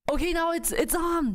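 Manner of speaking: high, hyped-up get-ready voice; psyched up. Retold or imitated. Mom retelling bracing herself in labor, high and hyped. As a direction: pump yourself up for the push. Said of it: "So I'm like".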